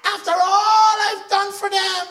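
high, wailing hurt voice; hammed-up wounded cry; mock self-pity. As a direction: whine like someone betrayed. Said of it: "we act like".

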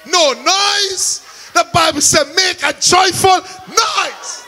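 building from firm to high shouted praise; full-force preaching. Acted. Preacher rousing the church, rising to a shout. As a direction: preach it up until you're shouting to dance.

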